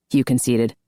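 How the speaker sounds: ordinary delivery, nothing marked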